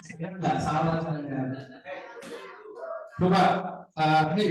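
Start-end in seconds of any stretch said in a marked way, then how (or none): none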